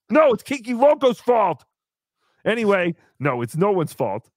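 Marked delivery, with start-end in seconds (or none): none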